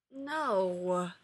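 a touch of misery; mildly miserable no. A narrator's reading. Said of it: "said Evan miserably".

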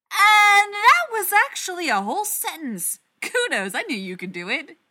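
over-the-top mock kudos; loud sarcastic praise. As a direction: applaud him sarcastically. Sarcastic praise after curt reply.

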